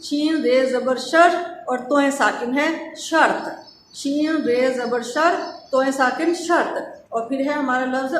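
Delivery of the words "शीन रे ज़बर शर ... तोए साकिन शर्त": slow, deliberate letter-by-letter spelling. Shown as a demonstration. Teacher spelling शर्त slowly for the children. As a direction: spell it out slowly for young learners. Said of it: "शर्त के लिए आवाज़ क्या होगी?".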